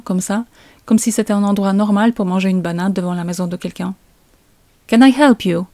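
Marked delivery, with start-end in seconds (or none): 4.9-5.7 raised, higher voice hailing someone; acted challenge to an unexpected visitor; calling out to a stranger. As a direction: call it out in English, pitched up. Rose calls out to the stranger.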